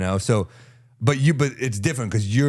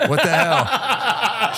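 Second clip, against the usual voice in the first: high, drawn-out, laughing what the hell; exasperated friend; 13 semitones higher, much slower than usual. Retold or imitated. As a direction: stretch it out in disbelief. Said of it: "And I was like".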